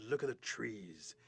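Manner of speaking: slow, stretched warning; awed deer. Acted. Deer pointing ahead to trees, slow awe. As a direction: drop into slow amazement. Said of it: "Deer points ahead and says".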